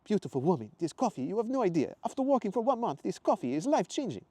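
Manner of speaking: high, loud Italian gushing; grateful Italian accent. Acted. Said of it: "Matteo said with a deep gratitude".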